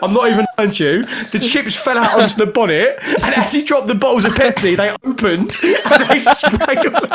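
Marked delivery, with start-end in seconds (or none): 1.3-7.1 words squeezed out through laughter; telling the story while cracking up; laughing while telling. Real, not acted. Laughing to mates over the chicken disaster. Retelling the funniest mishap.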